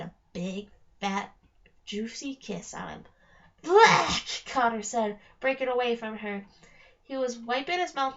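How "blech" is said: loud, high cry of revulsion; disgusted blech. Acted. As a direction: spit it out like a bad taste. After the troll's kiss.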